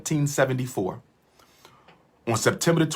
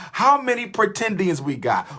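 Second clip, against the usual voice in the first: higher, calling out to the audience; 8 semitones higher than usual. Real, not acted. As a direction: call it out to the whole live chat. Repeats question to livestream audience.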